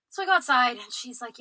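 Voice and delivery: ordinary talk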